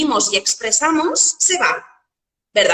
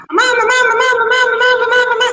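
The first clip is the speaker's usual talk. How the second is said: put-on high, loud child calling mamá over and over; a child tugging at mum, much higher and louder; nagging child; 12 semitones higher, 5 dB louder, much faster than usual. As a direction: pester like an impatient little kid. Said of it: "viene el niño y empieza".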